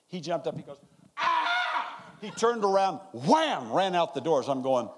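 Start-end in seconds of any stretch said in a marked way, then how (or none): 1.2-2.1 very high startled scream; retold scream. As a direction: shriek like a man bolting away. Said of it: "He screams and leaves".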